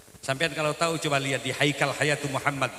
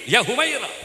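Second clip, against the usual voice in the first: high and loud, declaimed; proud proclamation; 8 semitones higher, 5 dB louder than usual. Retold or imitated. Preacher stressing the Prophet's words, high and loud. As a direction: announce it with emphasis. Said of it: "Lah Nabi begitu".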